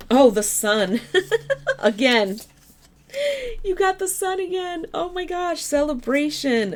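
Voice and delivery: delighted high squeal with a laugh; thrilled surprise at a lucky card; excited. Real, not acted. Excited about drawing the sun card.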